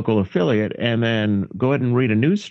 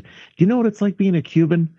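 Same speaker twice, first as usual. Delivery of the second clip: higher-pitched, animated sitcom complaint; exasperated Cuban character; 6 semitones higher than usual. Acted. Quoting a Cuban trying to get on TV, exasperated. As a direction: act out the Cuban's indignant question, pitched up. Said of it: "The funniest thing he said".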